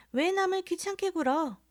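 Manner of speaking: put-on high, sing-song voice of a young lady, 왜 남을 귀찮게 굴어 with 남을 lifted; mock the lady's piping voice; mimicking a young woman. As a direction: drunk man mimicking the snooty young woman. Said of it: "정말 꾀꼬리 같은 소리를".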